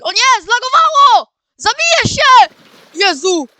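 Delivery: high, loud scream of alarm; high-pitched startled shouting; panicked yell. Real, not acted. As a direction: yell out in real shock at a sudden scare. Startled by game lag, jumpscare.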